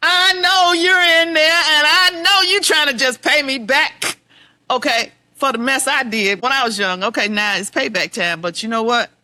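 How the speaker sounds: loud, high, slowed at first; half-laughing reproach to her mother; indignant accusation. Retold or imitated.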